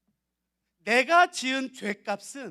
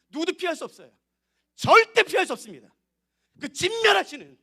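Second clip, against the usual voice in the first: full-force preaching; 6 semitones higher, 8 dB louder than usual. Acted. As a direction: thunder out the warning of judgment.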